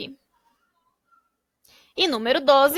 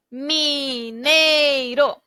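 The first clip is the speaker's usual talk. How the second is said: slow syllable blending; much slower than usual. Shown as a demonstration. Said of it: "teacher blends syllables slowly".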